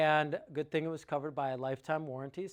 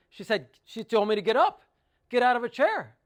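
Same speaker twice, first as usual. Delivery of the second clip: loud, higher exclamation; imitating agitated client; 7 semitones higher, 11 dB louder than usual. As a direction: call it out in mock indignation. Acting out agitated client's story.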